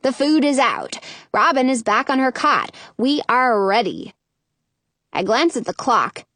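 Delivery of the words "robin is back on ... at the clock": ordinary delivery, nothing marked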